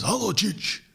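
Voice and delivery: high-pitched cartoon dad on the phone; hammed-up older man answering; mate's dad. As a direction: greet in a squeaky put-on voice. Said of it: "And he goes".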